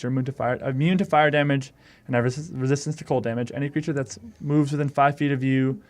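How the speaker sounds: usual speaking voice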